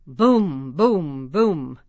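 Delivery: loud booming stomps, fading; elephant steps. Pretend. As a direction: boom like a heavy elephant. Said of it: "Stomp feet".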